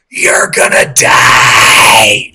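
loud, slow, drawn-out yell; imitating a stranger's doom scream; retold scream. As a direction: stretch it out like a street warning. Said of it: "a homeless woman screams at me".

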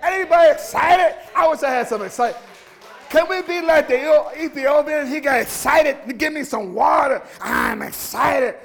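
loud, high, impassioned preacher; fired-up pulpit shout, key words stretched; full-force preaching. Real, not acted. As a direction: rouse the congregation with shouted exhortations.

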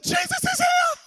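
very high, loud jubilant shout; retold ecstatic holler; excited calling out. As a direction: proclaim it like a revival has broken out. Excited father calls out revival.

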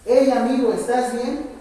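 loud, energetic greeting; energetic call. Shown as a demonstration. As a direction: call out with energy to wake him. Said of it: "los quiero enérgicos".

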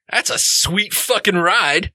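high, slow admiration; impressed orc. Acted. Orc looks over the player's ride, impressed. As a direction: draw it out high, truly impressed.

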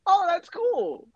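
high-pitched, drawn-out delighted reaction; pleasantly impressed. Retold or imitated. Fan recalling first hearing the accent, pleasantly impressed.

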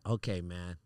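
calm him down gently; reassuring. Retold or imitated. Consoling a distressed friend, retold.

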